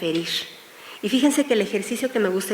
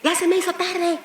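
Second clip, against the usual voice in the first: mild mock yell, higher pitched; marido gritando; 8 semitones higher than usual. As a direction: imitate a husband calling out, late and impatient. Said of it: "el marido ya grita". (marido que grita, a media voz)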